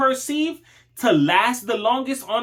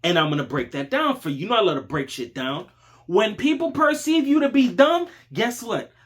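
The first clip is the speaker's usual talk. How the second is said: ordinary talk, nothing marked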